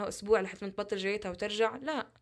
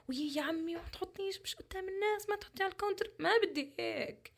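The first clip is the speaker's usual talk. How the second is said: high, soft hushed imitation of a nervous shopkeeper; lightly voiced fussy shopkeeper; mock shopkeeper; 9 semitones higher, 5 dB quieter than usual. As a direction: act out the embarrassed shop man hiding it away. Mimics shopkeeper hiding pads.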